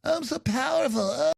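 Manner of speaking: high, breathy, self-adoring mock voice; mocking parody, pitched high; mock decadent elite. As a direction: swoon over your own power. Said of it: "they're just like".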